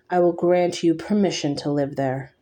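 plain reading voice

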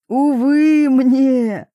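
high, loud, drawn-out lament; anguished cry. A narrator's reading. Papa refuses to calm down, anguished cry. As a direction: cry it out slowly in theatrical woe. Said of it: "вскричал папуля".

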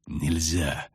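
low, restrained refusal; through clenched teeth. A narrator's reading. Witcher refuses to run, speaking through clenched teeth. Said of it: "стиснул зубы ведьмак".

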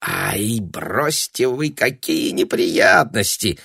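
slow, drawn-out dismissal; waving it off. A narrator's reading. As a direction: brush it off, stretching the words. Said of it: "отмахнулся Папандополо".

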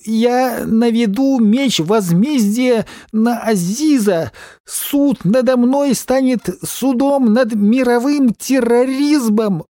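high, slow, grand protest oratory; rally speech. A narrator's reading. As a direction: declaim like a street orator. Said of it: "Пока он митинговал".

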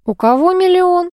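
high, drawn-out nosy question; intrigued, stretching the words upward; curious surprise. Acted. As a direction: pipe up with sudden interest, pitched high. Man cuts in, curious.

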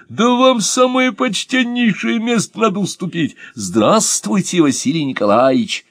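high, delighted welcome; joyful greeting. Acted. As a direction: greet him like a long-lost friend. Joyful greeting of old acquaintance. (радостное приветствие, высоко)

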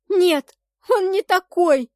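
high, loud, drawn-out cry; anguished wailing denial; sobbing protest. A narrator's reading. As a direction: protest through sobs. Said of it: "всхлипывала я".

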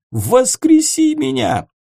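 high, loud desperate demand; desperate shout. Acted. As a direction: scream the demand at him. Ghost demands revival.

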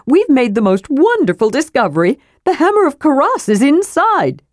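high, eager storybook adventurer; eager high-voiced little fellow; excited kender. A narrator's reading. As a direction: share a thrilling discovery, pitch climbing.

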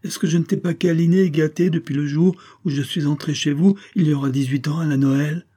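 high, loud outburst easing into reproach; indignant cry. A narrator's reading. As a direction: protest hotly, wounded by the slight. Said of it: "cria Céline indignée". (protestation indignée)

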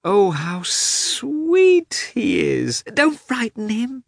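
high, slow, gushing over a sparrow; delighted exclamation. A narrator's reading. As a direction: melt at a tiny bird. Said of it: "exclaimed Kitty unexpectedly".

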